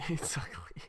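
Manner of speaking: quiet, breathy laugh through the words; laughing under the breath. Real, not acted. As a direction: say it through a helpless little laugh. Gives in, laughing at own build.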